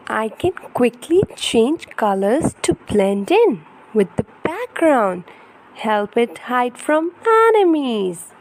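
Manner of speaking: put-on lively, sing-song storybook reading for a toddler; reading to children. Parent reading an ocean picture book to baby, animated storytelling. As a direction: read it brightly to hold a little one's attention.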